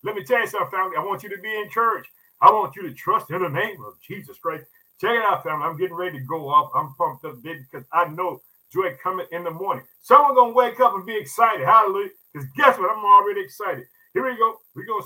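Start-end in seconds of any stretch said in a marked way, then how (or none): none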